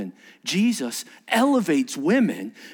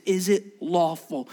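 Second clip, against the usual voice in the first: ordinary talk, nothing marked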